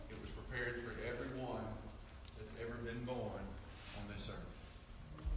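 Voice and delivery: low, very soft sermon voice; hushed, solemn preaching. Real, not acted. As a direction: bring the congregation down to a hush.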